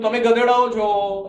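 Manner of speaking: slow, loud scolding, words spaced out. Retold or imitated. Teller scolding the embarrassed pair, slow and loud. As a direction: tell them off like a fed-up elder.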